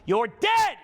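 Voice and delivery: high loud burst; sudden shout. Acted. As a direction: jolt them awake. Sudden burst mid-meditation.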